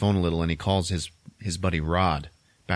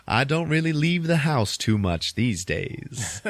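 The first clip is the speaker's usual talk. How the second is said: slow, higher put-on voice; odd genteel guest; 4 semitones higher, slower than usual. [name] answering at the party, slow put-on cheer. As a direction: draw it out as an oddly cheerful character.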